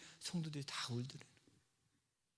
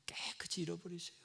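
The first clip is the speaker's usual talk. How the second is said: whispered, much softer; hushed whisper; 2 semitones higher, 8 dB quieter than usual. Real, not acted. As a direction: draw the congregation in with a whisper. Preacher stresses God's forgiveness.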